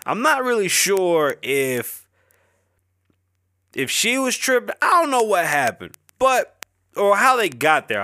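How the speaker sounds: high, slow disbelief; incredulous. Real, not acted. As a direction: stretch it out, baffled. Incredulous retelling of fight video.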